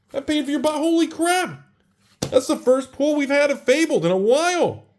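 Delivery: yelling at John over a huge pull; ecstatic screaming. Real, not acted. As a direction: scream like you just won the lottery. Pulls rare Fabled card, screams.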